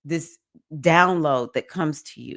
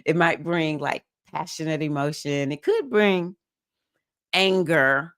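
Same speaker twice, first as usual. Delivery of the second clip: ordinary talk, nothing marked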